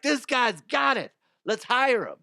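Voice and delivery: high, excited mock employer; impressed hiring bosses. Bosses deciding to hire him, high excited mock voice. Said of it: "They're like".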